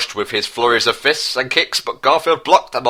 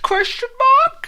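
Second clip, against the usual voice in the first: very high falsetto squeak, slow; squeaky question; slower than usual. Acted. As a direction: squeak it out like a puzzled cartoon. Reader squeaks the final words.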